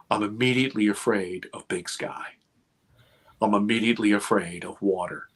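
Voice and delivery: ordinary talk, nothing marked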